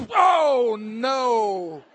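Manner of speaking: big loud groan falling away; mock anguish. Big man wedging into middle seat, mock anguish. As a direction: bellow it out in hammed-up dismay.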